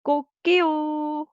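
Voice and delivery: high, loud crow with a long held last syllable; Korean rooster crow. Shown as a demonstration. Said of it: "but Korean we say".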